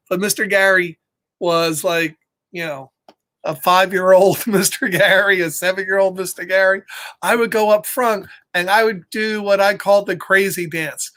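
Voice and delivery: ordinary delivery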